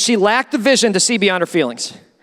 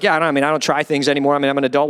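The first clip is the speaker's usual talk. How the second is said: dismissive adult; 10 semitones lower, faster than usual. Retold or imitated. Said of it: "most of us are like".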